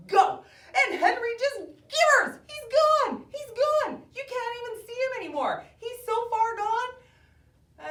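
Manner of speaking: high, loud burst of race excitement; thrilled, high-pitched yelling; excited race call. Acted. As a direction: shout it out as the racer bolts off. Race start, hare shoots off.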